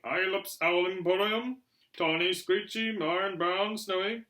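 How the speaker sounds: announced shop sign. A narrator's reading. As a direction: proclaim the shop's wares item by item. Shop sign read out as advertisement.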